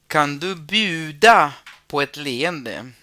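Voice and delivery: slow, careful "Kan du bjuda på ett leende?" with stress on "bjuda"; slow model reading. Shown as a demonstration. Teacher modelling the phrase for Spanish-speaking students, slowly. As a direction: spell it out slowly for learners. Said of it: "model sentence read to class".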